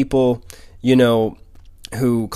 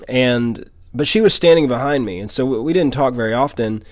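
usual speaking voice

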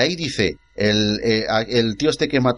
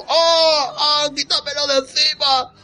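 much higher, hammed-up scream of panic; mock panicked yell; slower than usual. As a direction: play a man with something clamped to his face. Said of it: "el tío ahí gritando". (grito de pánico exagerado, muy agudo)

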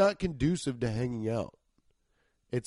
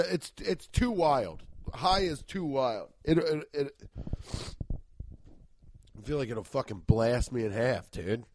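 ordinary talk

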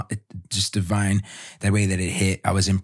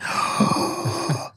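awed gasp; 5 semitones higher than usual. Retold or imitated. Overwhelmed at birth.

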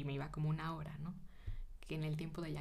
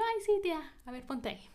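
much higher, louder cheerful "ay, sí"; eager yes; 10 semitones higher, 7 dB louder, faster than usual. Retold or imitated. Agreeing to massage her aunt's back, eager high burst. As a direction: burst out gladly to help.

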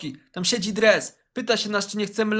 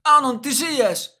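relieved joy; 4 semitones higher, 5 dB louder than usual. Retold or imitated. Friends see him alive.

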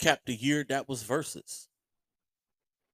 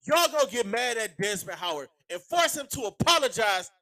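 much higher, furious rant; angry rant; 8 semitones higher than usual. Real, not acted. Podcaster blasting the fan base, furious shout. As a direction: let them have it, fed up. Ranting despite sore throat.

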